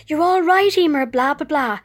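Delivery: sing-song mockery of empty reassurance; mocking nurse. Patient parodying the nurse's brush-off: mocking sing-song. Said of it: "mocking repeat of nurse".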